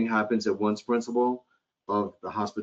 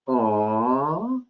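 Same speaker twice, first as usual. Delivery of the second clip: sympathetic aww; 3 semitones higher than usual. Shown as a demonstration. Clerk reacting to a guest's new baby with a tender aww. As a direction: coo at the news of a new baby. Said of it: "Are you ready?".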